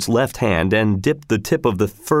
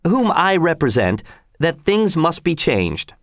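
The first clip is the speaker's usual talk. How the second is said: high, formal delivery, slowing at the end; prompted spokesman; 8 semitones higher than usual. A narrator's reading. Spokesman addressing the governor after a prompt, high and formal. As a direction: repeat the prompt aloud, pitched up.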